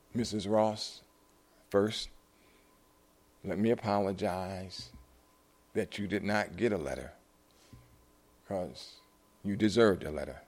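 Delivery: soft, halting, sincere apology; quiet and heartfelt; solemn apology. Retold or imitated. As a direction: measure out each phrase gently, with long pauses. Solemn apology after being stunned.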